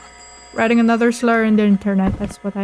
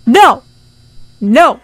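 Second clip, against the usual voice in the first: shouted no, louder; loud refusal; 9 dB louder than usual. Acted. Clerk refusing the customer's plea, loud shouted no. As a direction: bark the refusal.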